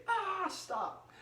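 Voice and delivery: squeaky mock-annoyed parent; fed-up parent. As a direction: play a parent sick of the hooting. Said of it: "mom or dad say".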